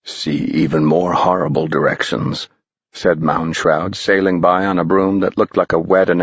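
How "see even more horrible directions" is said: put-on low, sly old spirit; a spooky guide teasing boys; low, strange older voice; eerie old man. A narrator's reading.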